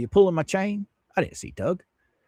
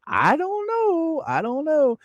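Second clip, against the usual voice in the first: voicing Venom; 12 semitones higher than usual. Streamer reads viewer Venom's comment, high mock voice. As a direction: read the chat line in a squeaky mock voice. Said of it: "Venom says".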